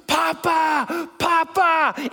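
high, excited mock scream of Papa; retold toddler scream. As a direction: cry out like a delighted little girl. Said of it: "she screams".

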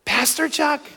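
high-pitched, held-back imitation of a scream; mild mock scream. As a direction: squeal the name without real volume. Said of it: "and she screamed".

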